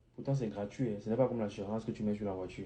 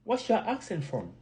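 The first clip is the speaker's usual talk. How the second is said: higher-pitched mock American questioner; imitated American accent; 10 semitones higher than usual. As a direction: put on an American's curious question. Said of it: "Souvent, un Américain qui dit".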